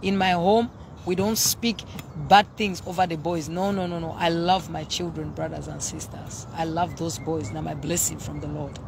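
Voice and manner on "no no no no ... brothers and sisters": ordinary talk, nothing marked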